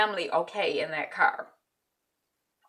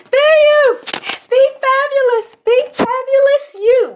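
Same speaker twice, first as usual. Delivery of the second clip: belted catchphrase; 12 dB louder, slower than usual. Shown as a demonstration. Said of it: "you know what you gotta do".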